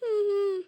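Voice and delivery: short tearful sobs; sobbing. Acted. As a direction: choke back a sob. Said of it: "ಅಳ್ಬೇಡ, ಸುಮ್ನೆ ಇರು". (ಬಿಕ್ಕಳಿಸಿ ಅಳು)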